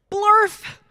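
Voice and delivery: loud high "Blork" blurted out; nonsense word. Pretend. Reading the silly word aloud to the kids, loud and high. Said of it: "even if the words say".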